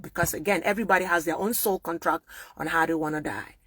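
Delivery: ordinary talk, nothing marked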